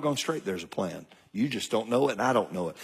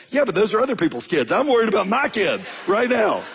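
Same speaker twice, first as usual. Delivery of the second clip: higher, louder worried protest; exasperated dad; 10 semitones higher, 5 dB louder than usual. Retold or imitated. Dad pleading with daughter about unpaid job, exasperated. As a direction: push back, half laughing at the news. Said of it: "I went".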